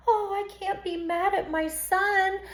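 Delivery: high, soft doting mother; mock indulgent mother. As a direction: coo it like a mother excusing her son. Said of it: "mother's excuse".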